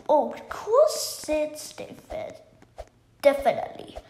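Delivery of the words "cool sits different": ordinary talk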